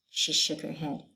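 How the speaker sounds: ordinary delivery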